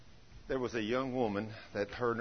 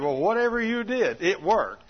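amazed; 8 semitones higher, 7 dB louder than usual. Retold or imitated. Amazed mother retold.

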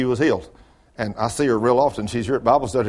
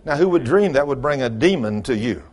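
ordinary delivery

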